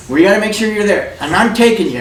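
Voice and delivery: Ida insisting. Retold or imitated. Ida insisting on driving him.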